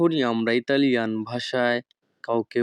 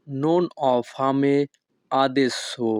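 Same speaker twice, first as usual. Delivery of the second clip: each Italian word spoken slowly and clearly; teacher modelling Italian for learners, very slow; slow demo; much slower than usual. Shown as a demonstration. Bengali teacher models Italian phrase for students, slow and careful. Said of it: "Italian phrase demo".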